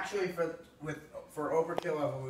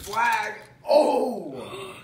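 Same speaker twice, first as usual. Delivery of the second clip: excited shout; 7 semitones higher, 11 dB louder than usual. Real, not acted. Truck race flag drops.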